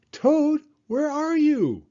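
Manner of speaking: high, loud call into a dark house. Acted. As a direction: call for a hidden friend. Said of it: "called Frog".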